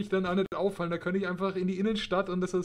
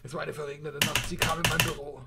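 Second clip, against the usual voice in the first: low, quiet film-noir narrator; brooding hardboiled detective voiceover; 5 semitones lower, 3 dB quieter than usual. Pretend. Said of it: "Noir-Detektiv sa-, schreiben kann".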